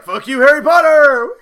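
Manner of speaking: shouted high and loud through a laugh; laughing mock shout; mock taunt. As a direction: bark the insult like a cocky wizard. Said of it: "he's like".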